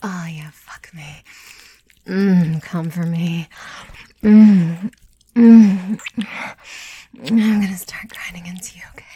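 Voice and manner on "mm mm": two loud closed-mouth moans; pleasured moan. Acted. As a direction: let out long, loud hums of pleasure. Intimate scene, wordless moaning.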